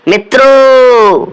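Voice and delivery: teacher's hammed-up Modi address to the nation; Modi impression. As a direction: stretch the word out like Modi opening a speech. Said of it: "Modi जी दिख रहे होंगे आपको".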